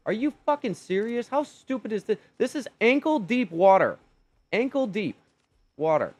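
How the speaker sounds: high, loud furious disbelief; angry rant. Real, not acted. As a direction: yell it at the stupid dino. Dino drowned in shallow water.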